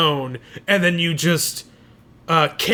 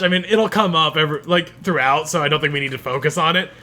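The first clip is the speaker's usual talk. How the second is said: normal talking voice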